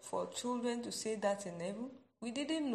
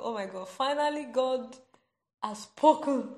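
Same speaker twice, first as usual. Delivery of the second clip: loud, joyful outburst; thrilled shout of praise; excited cry; 3 semitones higher, 9 dB louder than usual. Real, not acted. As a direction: burst out in delight that God has spoken. Joy that God has spoken.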